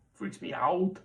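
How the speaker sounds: put-on a light Austrian-German accent on freaks me out; Arnold Schwarzenegger impression. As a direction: lightly mimic an Austrian-German accent like a little action hero. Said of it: "He's a mini Arnold Schwarzenegger".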